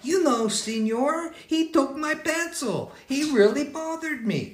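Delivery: put-on very high, urgent little girl voice; little girl complaining. Little girl runs up to tell the teacher, very high and urgent. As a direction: tattle to the teacher in a tiny high voice.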